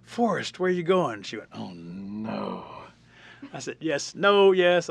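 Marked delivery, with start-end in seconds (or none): 0.0-1.2 high, loud question; playful raised call. Retold or imitated. Calls out the punchline setup. 1.5-2.6 drawn-out imitated groan; playful "oh no" of a listener who sees it coming; mock dismay. 3.8-4.9 loud, high, emphatic retort; raised, emphatic mock protest; insisting. Insisting against her objection.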